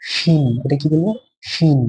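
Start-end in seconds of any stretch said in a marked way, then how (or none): 0.0-0.5 শিন with a thicker sh sound. Shown as a demonstration. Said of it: "এটা উচ্চারণ একটু মোটা হবে". 1.5-1.8 শিন with a thicker sh sound. Shown as a demonstration. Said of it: "এটা উচ্চারণ একটু মোটা হবে".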